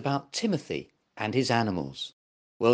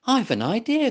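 high, bright burst of an idea; excited cry; 9 semitones higher, 3 dB louder than usual. Acted. Monkey hitting on a game idea, cries out excitedly. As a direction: blurt out the idea, pitched up. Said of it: "cried Woeful".

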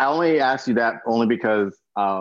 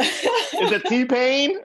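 high, drawn-out laughing question; 14 semitones higher, 5 dB louder, much slower than usual. Real, not acted. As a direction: giggle through the guess. Joking guess at mystery man.

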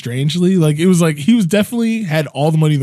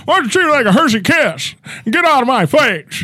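high, hammed-up politician impression; comic high-pitched impersonation; Joe Biden; 7 semitones higher than usual. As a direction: play an affronted politician, voice pitched up.

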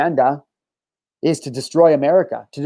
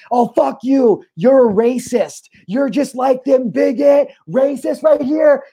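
higher, louder mocking rant with a shout; mock enraged commenter; 7 semitones higher, 3 dB louder, slower than usual. Podcaster mocks an outraged online commenter, yelling. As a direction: sneer it out like an outraged troll.

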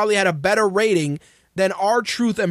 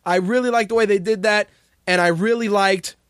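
normal talking voice

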